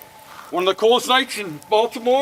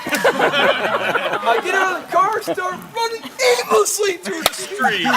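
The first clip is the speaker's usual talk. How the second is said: high, slow, laughing retelling; laughing through story; 10 semitones higher, slower than usual. Real, not acted. Recalling running lost through streets, laughing high. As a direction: laugh while telling it.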